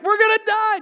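high, loud panicked cry; mock panic. Passengers on stuck log ride, mock panicked yell. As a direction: imitate a boatful of riders panicking. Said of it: "just 'cause she's crying".

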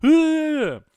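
very high, loud squealing outrage; mock outrage. A cyclist confronting the old biker, high mock outraged shriek. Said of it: "Just be like".

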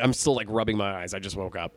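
plain reading voice

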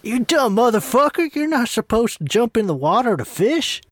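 loud, high-pitched exasperated outburst; hammed-up angry telling-off; angry scolding. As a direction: chew out a foolish fishing partner at full voice. Acted scolding in fishing skit.

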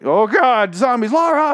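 high, loud hammed-up panic; mock alarmed [name]. [name] warning Laura of zombies, mock alarmed yell. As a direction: play the cowboy yelling a warning in mock fright.

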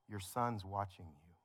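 soft, slow, low-voiced reminder; a quiet retold plea from the wife; hushed quiet warning. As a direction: say it gently under your breath to calm him. Wife calming him in a tense moment.

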